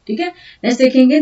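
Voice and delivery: ordinary talk, nothing marked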